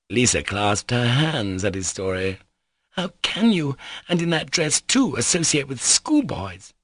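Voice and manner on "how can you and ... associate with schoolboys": high, indignant scolding; mild girlish outrage; angry cry. Acted. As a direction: take him to task crossly. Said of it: "she cried angrily".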